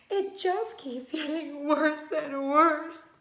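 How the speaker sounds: mother slowly lamenting, near tears. Acted. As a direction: let it break toward a sob. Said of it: "She began to sob".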